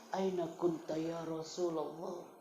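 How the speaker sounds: slow, soft, plaintive plea; a touch of a crying palm tree; lightly voiced weeping tree; mild whimpering. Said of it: "merengek nangis seperti bayi".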